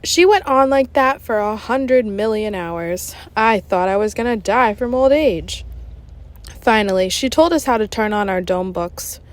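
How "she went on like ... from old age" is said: ordinary delivery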